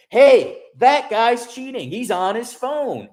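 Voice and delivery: loud, high, drawn-out accusation; accuser calling out. Retold or imitated. Someone from another table recognizes me, loud accusing call. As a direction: call it out across a room, high and slow.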